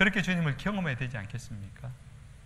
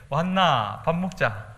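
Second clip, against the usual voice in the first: slow, drawn-out Daegu dialect from Jesus; a friendly Jesus speaking Gyeongsang dialect; 4 dB louder, much slower than usual. Acted. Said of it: "대구 경상도 말입니다". (대구 사투리로 다정하게 말하는 예수님)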